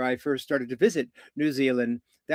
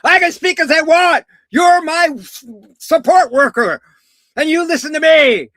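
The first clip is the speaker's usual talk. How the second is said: high, full-force furious shout; very loud acted tirade, pitched way up; screaming client; 11 dB louder than usual. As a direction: scream in defiance like an irate client. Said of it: "she's screaming at me".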